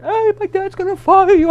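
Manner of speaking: put-on high, loud, panicked kid's blurt; scared boy warning the bartender he'll be fired, high and loud; retold shaking panic. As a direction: blurt it out trembling with alarm. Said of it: "I was, like, shaking".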